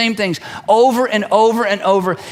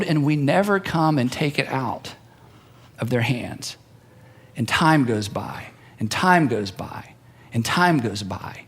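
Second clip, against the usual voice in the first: hushed slow repetition; 7 semitones lower, 5 dB quieter than usual. Real, not acted. Preacher slowing to a hush over time passing. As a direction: drop low and soft, slowing down. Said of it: "preacher slows and drops".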